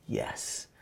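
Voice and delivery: a tiny breathless yes; whispered yes. Retold or imitated. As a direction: barely breathe the yes, shy. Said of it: "And he says".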